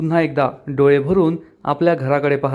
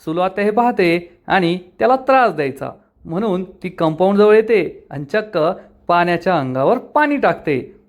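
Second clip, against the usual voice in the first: ordinary delivery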